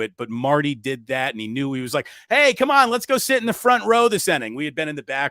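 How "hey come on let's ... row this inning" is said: high, louder rallying call; rally a friend with a raised voice; eager urging. Retold or imitated. Friend urging the group toward the front row, eager urging.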